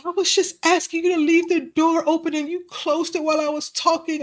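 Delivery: put-on high little-girl voice, hurt; imitating toddler. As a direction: play the upset two-year-old explaining herself. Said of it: "And she's like".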